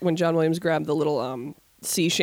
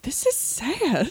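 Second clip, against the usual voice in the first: mock sad; 9 semitones higher, much slower than usual. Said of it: "I'm like".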